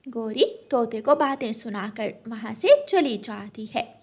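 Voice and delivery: plain reading voice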